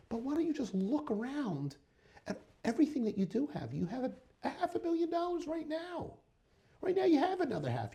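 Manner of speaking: replaying an excited plea to the man; retold pleading. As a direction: urge him excitedly, voice way up.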